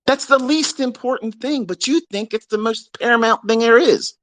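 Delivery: exasperated rise on the repeat. Real, not acted. Ranting at chart-obsessed students, pitch jumping up.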